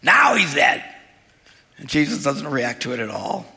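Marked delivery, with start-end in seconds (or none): 0.0-0.8 raised, loud mock blame; negative Nelly. Negative onlooker declaring the boy dead, accusingly. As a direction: imitate a complainer announcing the worst. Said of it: "people just negative Nellies".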